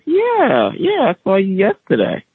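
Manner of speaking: high, breezy dismissive yeah; father brushing off his son, high breezy yeah; breezy dad. Retold or imitated.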